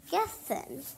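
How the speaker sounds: ordinary talk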